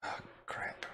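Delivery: whispered oh crap; whispering. Real, not acted. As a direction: breathe it under your breath. Dreading the intimidation roll.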